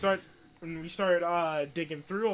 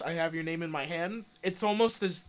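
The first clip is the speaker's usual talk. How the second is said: plain reading voice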